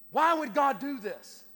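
angry protest. Acted. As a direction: act out an aggravated cry to God. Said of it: "he gets angry".

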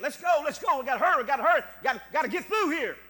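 loud, fast panicky rush; frantic hurry. Retold or imitated. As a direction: imitate people scrambling to get out. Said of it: "they would get".

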